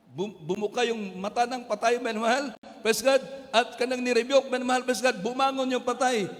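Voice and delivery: fervent shouted sermon; full-force preaching. Acted. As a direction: proclaim God's will to the congregation.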